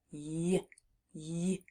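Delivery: Y, y as two soft, separate consonant sounds; isolated letter sound. Shown as a demonstration. Teacher isolating a hard sound, Y repeated softly alone. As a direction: say the y of yarn alone, softly, pausing between. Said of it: "it's a very hard sound to isolate".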